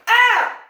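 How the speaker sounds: sharp, high martial arts yell; loud kiai. Shown as a demonstration. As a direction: shout the ki from the gut. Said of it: "with a loud ki on that third punch".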